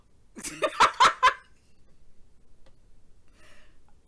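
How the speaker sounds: airy high-pitched laughing burst; breathy high laugh. Real, not acted. Recalling the jail call, a breathy laugh. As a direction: let out a wheezing laugh.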